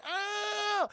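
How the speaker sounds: long high "oh" cry; mock scream. Heroes seeing the semi truck coming, mock alarmed scream. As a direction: wail in hammed-up alarm.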